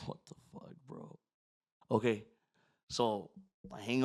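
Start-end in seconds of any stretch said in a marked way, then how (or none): 0.1-1.2 stunned whisper. Retold or imitated. Stunned reply to friend's prayer instruction.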